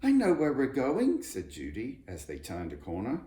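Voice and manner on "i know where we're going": high, loud burst of recognition; excited child's shout; excited cry. A narrator's reading. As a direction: call it out with delight. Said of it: "let out a cry".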